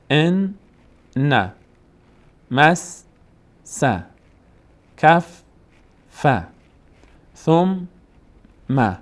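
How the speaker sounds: each pair sounded apart: "ان" / "نه", "مس" / "س", "کف" / "ف", "ثم" / "م"; doubled letters, split. Shown as a demonstration. Teacher shows the original spelling, syllables split by pauses. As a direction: hold "ان", "مس", "کف", "ثم" on the last consonant, pause, restart it. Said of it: "اصلشون اینطور بوده".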